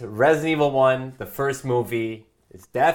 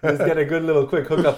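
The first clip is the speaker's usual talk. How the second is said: odd laughing creature voice, fast; hammed-up parasite villager voice; Los Plagas voice; 3 semitones higher, 4 dB louder, faster than usual. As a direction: rattle it off like a possessed villager, giggling. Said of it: "You sound like a fucking Los Plagas".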